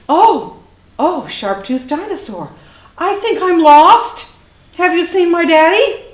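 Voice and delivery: gasp, then high, loud pleading; frightened little dinosaur. A narrator's reading. Little dinosaur meets sharp-toothed one, startled high pleading.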